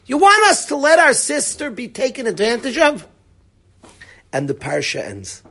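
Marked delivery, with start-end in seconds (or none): 0.1-3.1 high, raised-voice protest; indignant retort. Acted. Brothers say back to Yaakov about their sister, indignant. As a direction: fling the question back in outrage.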